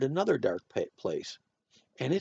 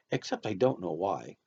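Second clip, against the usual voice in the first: plain reading voice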